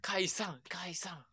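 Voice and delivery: hushed. Real, not acted. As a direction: drop it to a near whisper. Hushed mention of a touchy topic.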